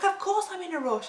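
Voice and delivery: high-pitched, indignant retort; retold exasperation. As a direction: throw it back, pitched well up. Retelling irritated reply to driver.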